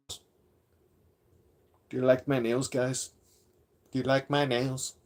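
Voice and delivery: soft, mincing mock impression of a rival; Padro. Streamer mimicking a rival showing off his nails, soft mocking impression. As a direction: tease him with a quiet put-on voice. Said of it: "Did I pull that off pretty good?".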